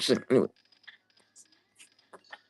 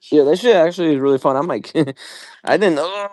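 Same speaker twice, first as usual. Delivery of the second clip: normal talking voice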